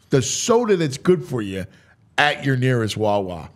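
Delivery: normal talking voice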